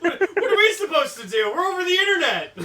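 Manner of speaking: shrieking with laughter; laughing high and loud. Real, not acted. Laughing exasperated reply.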